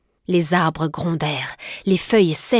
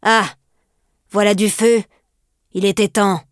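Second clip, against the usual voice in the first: louder, eager cry; exclaiming; 2 semitones higher, 4 dB louder than usual. Acted. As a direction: greet the fire, louder. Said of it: "s'écrièrent".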